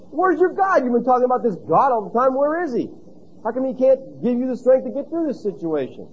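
high, taunting challenge from a scoffer; mocking world. The world taunting a complaining believer, high and mocking. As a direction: jeer at the believer's missing God.

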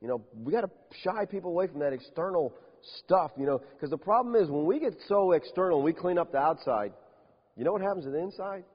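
ordinary talk, nothing marked

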